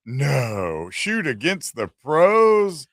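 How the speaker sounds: slow, drawn-out disbelief; sarcastic. Retold or imitated. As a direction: stretch it out in mock disbelief. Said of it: "He's being sarcastic".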